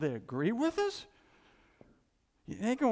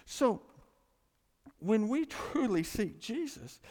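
normal talking voice

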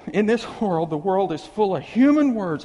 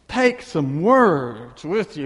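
slow and louder, words stressed; slow emphasis; 7 dB louder, much slower than usual. Acted. Preacher repeating the verse to the church, slow and loud. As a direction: lean on every word slowly.

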